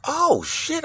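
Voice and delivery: retold alarm, pitched far up; a high startled curse; shocked. Realizing the hospital set-up, high shocked voice.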